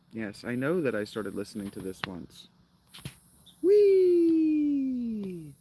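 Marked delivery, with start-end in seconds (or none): 3.6-5.5 long high squealed whee; playful wheee. Pretend. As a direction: let out a loud, drawn-out, high wheee. Playful squeal between remarks.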